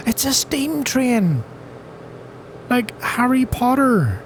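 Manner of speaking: gasp, then high drawn-out delight; high, excited and stretched out; thrilled discovery. Real, not acted. Spots a train in the game.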